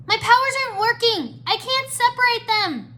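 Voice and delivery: very high, loud distressed cry; panicked cry. A narrator's reading. Irene finds her powers failing, panicked cry. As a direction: cry out in alarm that it's failing. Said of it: "she cried".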